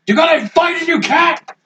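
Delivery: high shouted taunt; calling out challenge. Acted. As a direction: yell the dare across the field. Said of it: "I was gonna call out".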